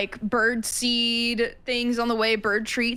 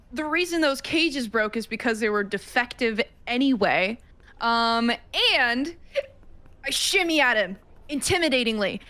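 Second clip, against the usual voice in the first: normal talking voice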